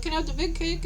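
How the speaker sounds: high, cheery quick request; happy, nice asking. Retold or imitated. Retelling her cake request in line, happy and nice. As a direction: ask eagerly with pitch lifted. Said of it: "And I'm, like, happy".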